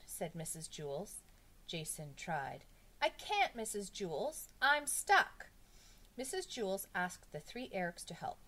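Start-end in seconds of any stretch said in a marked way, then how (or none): 3.0-5.5 high, loud schoolboy whine; raised, pleading cry; distressed complaint. A narrator's reading. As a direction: protest helplessly to the teacher. Stuck pupil complains to teacher.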